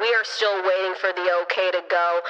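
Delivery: low, monotone announcer over a PA; mock gate agent on the intercom; airline intercom announcement. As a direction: deepen the voice into a muffled intercom drone. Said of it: "I hear on the intercom".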